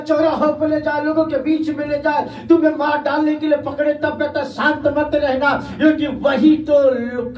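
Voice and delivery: high, loud, sustained pulpit cry; impassioned preacher acting out a defiant martyr; full-force preaching. Real, not acted.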